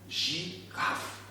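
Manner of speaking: "girafe" split as gi... rafe, hushed; "girafe" as a quiet zhee, pause, raf; syllable by syllable. Shown as a demonstration. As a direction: sound out "girafe" softly in separate beats. Teacher repeats word in syllables.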